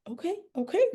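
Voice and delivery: high, startled okay okay; surprised and shocked. Retold or imitated. Mom, shocked by her daughter, answers surprised. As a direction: caught off guard, giving in. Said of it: "she was like".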